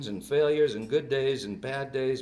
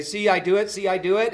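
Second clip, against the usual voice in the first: put-on higher, louder voice of a small child showing off; excited little girl; 7 semitones higher, 8 dB louder than usual. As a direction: imitate a proud toddler calling out. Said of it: "and she would say".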